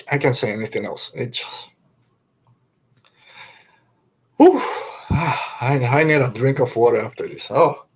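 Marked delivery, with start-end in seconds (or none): 1.1-7.5 breath catching, near tears; fighting back real tears, then a shaky exhale; choked up. Real, not acted. Overcome with emotion after the scene.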